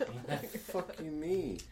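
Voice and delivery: high, slow, stretched outrage; hammed-up disbelief, drawn out; outraged. Retold outrage.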